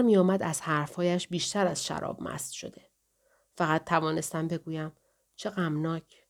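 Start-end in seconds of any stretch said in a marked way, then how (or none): 5.4-6.3 soft, understated sorrow; a touch of sadness, kept small; subdued comment. A narrator's reading. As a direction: murmur it softly as a quiet statement. Narrator's subdued reply, then echoed.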